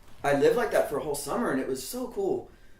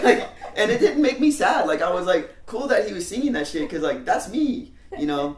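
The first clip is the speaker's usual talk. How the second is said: loud, high laughing delivery; 8 semitones higher, 7 dB louder than usual. Real, not acted. Telling the audience about the song while laughing. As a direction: laugh through it proudly.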